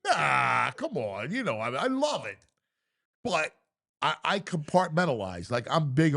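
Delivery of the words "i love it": ordinary talk, nothing marked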